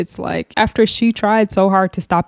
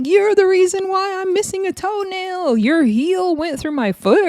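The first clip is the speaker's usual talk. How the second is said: high mock drunk whine; 12 semitones higher than usual. Oxy Pearl drunk accusation.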